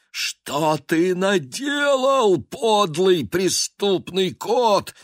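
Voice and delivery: high, slow, shouted accusation; exclaimed. Acted. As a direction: shout it in outrage. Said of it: "воскликнули они разом".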